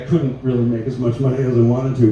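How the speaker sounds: ordinary talk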